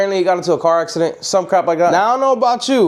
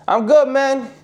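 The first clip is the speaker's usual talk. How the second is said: a bit higher, casual reply; lightly voiced Carlos; 7 semitones higher, much slower than usual. Carlos answers Tony casually, pitched up. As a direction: shrug it off. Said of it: "He's like".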